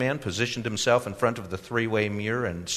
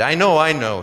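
loud, raised, exasperated concession; sighing; 6 semitones higher, 7 dB louder than usual. A narrator's reading. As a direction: give in with a touch of a sigh. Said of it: "he sighed".

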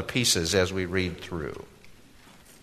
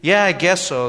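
woeful; 8 semitones higher, 7 dB louder than usual. A narrator's reading. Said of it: "said woefully".

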